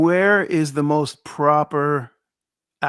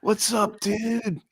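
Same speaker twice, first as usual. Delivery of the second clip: higher, softer stoner dude; stoner impression; 5 semitones higher, 7 dB quieter, much slower than usual. Mimicking Brits who do American accents, soft mock stoner. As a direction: draw out a hushed mock-stoner greeting. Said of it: "they always go right to the stoner".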